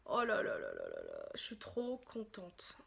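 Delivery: low murmur fading to a whisper; overwhelmed delight. Real, not acted. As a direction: breathe it out, too happy for full voice.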